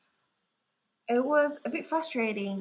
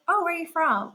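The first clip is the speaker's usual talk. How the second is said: high, loud nosy question; retold curious stranger; 8 semitones higher, 5 dB louder than usual. Strangers asking her origin, mock curiosity. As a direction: imitate someone eagerly asking.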